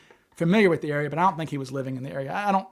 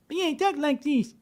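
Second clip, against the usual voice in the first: much higher squeaky imitation with a lisp; mock the suspect's odd voice, pitched way up; 11 semitones higher than usual. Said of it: "high-pitched lisp".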